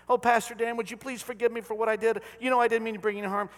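a hint of a sorry congregant; pleading sinner. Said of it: "We're like begrudging people".

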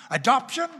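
usual speaking voice

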